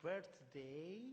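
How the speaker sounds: put-on voice, very slow and drawn out; Jeffrey Boycott. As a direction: stretch each word in a mock-stately imitation. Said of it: "He said".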